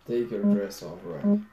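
barks the order, rising loud and high on the name; commanding shout. A narrator's reading. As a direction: orders her sharply, voice climbing on Ronnie. Boss orders the girl.

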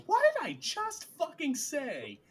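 high, loud mock outburst; exasperated Faile. Host imagining Faile scolding Perrin, high and loud. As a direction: snap it like a fed-up wife. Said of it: "I want Faile to just go".

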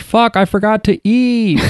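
high, drawn-out pretend cry; mock yell. Host guessing the dead man's last words, mock yell. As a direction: wail it out without real volume. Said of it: "maybe he yelled".

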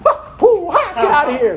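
hammed-up karate yelps; mock fighting reaction. Preacher acting a believer hitting back, mock fight cries. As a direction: bark it like you're squaring up.

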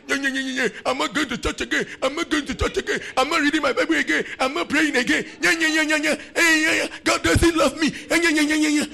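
high mocking whine of a sulking believer; mock a pouting churchgoer, high and childish; preacher mimicking a sulking member, high whiny voice; sulky whiner.

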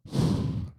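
a big loud gasp for air after rushing; loud, real gasp for breath. Shown as a demonstration. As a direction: gulp in air like you ran out of breath. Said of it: "and then go".

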